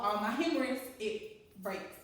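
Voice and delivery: ordinary delivery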